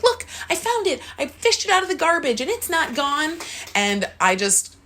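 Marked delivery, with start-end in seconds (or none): 0.0-3.4 put-on much higher, proud little-kid voice; like a child showing off a treasure it found. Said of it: "I felt my little pride at".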